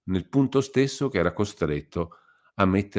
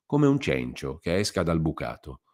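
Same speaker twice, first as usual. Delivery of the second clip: usual speaking voice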